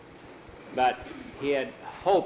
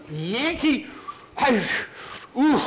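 lovestruck swoon; 11 semitones higher, 4 dB louder, slower than usual. Acted. Skeeter swooning over Nancy.

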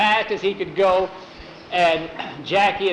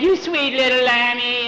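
teasing coo; 7 semitones higher than usual. Acted. Skeeter petting and pinching Mike, teasing coo. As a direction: coo sweetly to needle him.

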